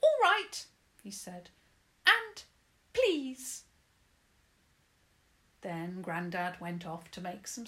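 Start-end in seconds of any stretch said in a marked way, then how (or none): 0.0-0.7 high, loud sulky pixie. Acted. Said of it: "Herbin looked sulky". 2.1-3.6 high, loud sulky pixie. Acted. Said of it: "Herbin looked sulky".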